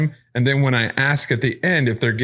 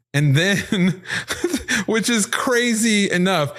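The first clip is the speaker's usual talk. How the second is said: laughing; 9 semitones higher, slower than usual. Real, not acted. Host laughs at spooky clip.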